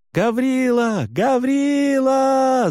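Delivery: high, long-drawn call for help; desperate shout. A narrator's reading. Novice driver calls Gavrila for help, shouting. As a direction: cry the name out, stretching the vowels. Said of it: "закричал я, что есть мочи".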